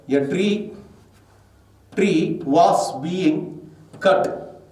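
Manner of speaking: very slow, word-by-word read; drawn-out dictation of the answer. Shown as a demonstration. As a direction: spell the answer out slowly for the class. Teacher dictating passive sentence.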